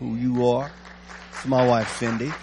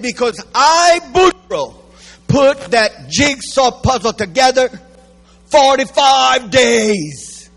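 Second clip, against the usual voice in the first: higher, louder boast, slowing on the last words; proud Boudreaux; 8 semitones higher, 6 dB louder, slower than usual. Acted. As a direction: crow about the puzzle, stretching forty-five days.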